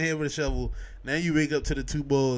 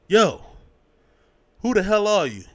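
louder, higher mock challenge; mock yell; 5 semitones higher, 5 dB louder than usual. Dad yelling at a stranger in his house, mock shout. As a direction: bark it like a startled dad.

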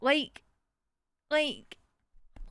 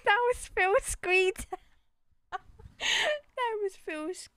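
higher, struggling to speak through laughter; real laughing fit; 7 semitones higher, slower than usual. Real, not acted. Player repeating their own slip, laughing helplessly. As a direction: repeat it while cracking up, pitch jumping up.

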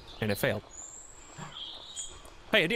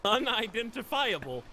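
slow fake sob, pitched up; mock crying; 7 semitones higher, slower than usual. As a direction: whimper it through fake tears. Mock crying over the body.

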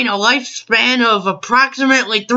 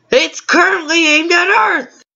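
alarmed; 7 semitones higher than usual. Acted. Alien realizes danger.

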